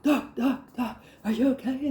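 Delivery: hammed-up worried dad voice; panicked dad. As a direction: call out in repeated urgent bursts. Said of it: "He's like".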